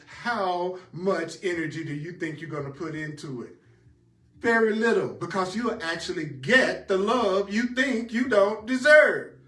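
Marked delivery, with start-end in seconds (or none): 4.4-9.3 preaching build. Acted. Preaching climax.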